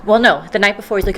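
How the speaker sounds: ordinary delivery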